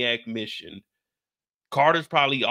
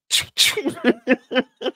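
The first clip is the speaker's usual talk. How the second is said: high, breaking giggle; high giggle burst; 12 semitones higher, 5 dB louder than usual. Real, not acted. Cracking up mid-sentence.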